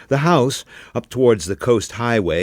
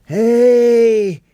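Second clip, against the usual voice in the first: much higher, loud calling out; distant shout; 8 dB louder than usual. A narrator's reading. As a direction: yell across the beach to get attention. Boy calling.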